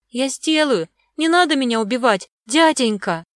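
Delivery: put-on high, pleading child's voice; timid, frightened girlish plea; scared babbling. A narrator's reading. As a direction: beg for mercy like a little girl. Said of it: "залепетала Огнева".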